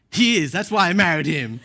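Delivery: loud, high comeback imitating a wife; wife's proud retort. Wife defending her husband to a rude stranger, loud imitated retort. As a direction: jump loudly to your husband's defence.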